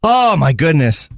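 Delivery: ordinary delivery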